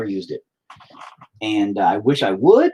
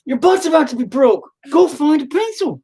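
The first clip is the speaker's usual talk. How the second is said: loud, high parental order; fed-up parent, loud and high; exasperated retort; 14 semitones higher, 5 dB louder than usual. Real, not acted.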